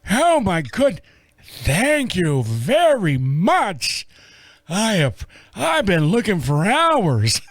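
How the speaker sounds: put-on slow, mild old man thanks; a touch of an elderly man; grateful old man. The eighty-year-old thanking the narrator, slow grateful old voice. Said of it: "old man thanking".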